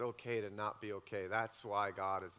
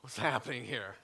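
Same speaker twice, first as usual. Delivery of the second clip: retold panic; 3 semitones higher, 8 dB louder than usual. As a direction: replay his alarm at her crying, building to a shout. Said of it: "I'm like".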